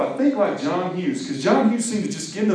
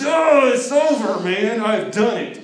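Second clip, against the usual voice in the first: high, slow, loud victory cry; triumphant shout; 7 semitones higher, 4 dB louder, slower than usual. Acted. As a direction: shout like you've conquered the day. Bender triumphant at the end.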